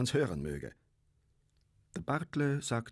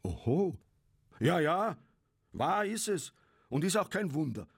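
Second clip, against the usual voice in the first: high, emphatic, worked-up retort; heated, swooping exclamations; angry outburst; 8 semitones higher than usual. A narrator's reading. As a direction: burst out in indignation. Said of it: "schrie Bartle mit zornrotem Gesicht".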